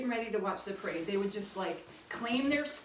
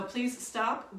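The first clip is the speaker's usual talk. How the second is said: ordinary talk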